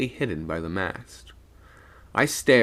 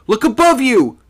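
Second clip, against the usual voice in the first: very high, loud warning; almost shriek it, pitched far up; near shriek; 13 dB louder than usual. A narrator's reading. Sailor warning Plummer of something descending, almost shrieking. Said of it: "I almost shrieked".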